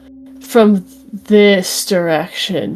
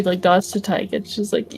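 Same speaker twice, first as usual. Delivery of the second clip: ordinary talk, nothing marked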